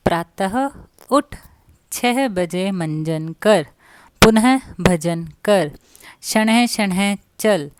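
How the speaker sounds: slow, clear reading, each phrase spaced out; slow read-along. Shown as a demonstration. Teacher reading the paragraph slowly with her class. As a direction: read it slowly so the children can follow. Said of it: "पढ़ते हैं साथ साथ".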